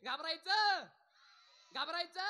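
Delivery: put-on loud, high call to the children. Shown as a demonstration. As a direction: shout the word for the crowd to echo. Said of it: "मोठ्यानं बोलायचं".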